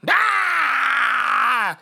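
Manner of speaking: long high loud wail; hammed-up drawn-out cry; mock scream. Adult soaked by kids, mock wailing scream.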